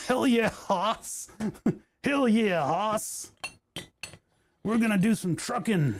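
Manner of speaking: put-on playful good-ol'-boy voice. Macho trucker bit.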